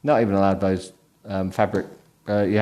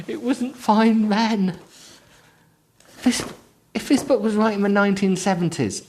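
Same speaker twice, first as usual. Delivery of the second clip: 1 incredulous rant; 11 semitones higher than usual. Real, not acted. Reader ranting at the book.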